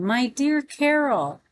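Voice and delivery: delighted to see a loved one, mildly acted; joyful exclamation. A narrator's reading. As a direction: exclaim it fondly, lifting the pitch and stretching it. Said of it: "I exclaimed with joy".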